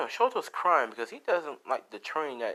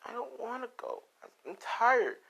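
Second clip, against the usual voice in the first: put-on much higher whining little-boy voice; whiny child; 13 semitones higher than usual. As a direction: whine like a tired small kid refusing to go. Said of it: "As Shoto's like".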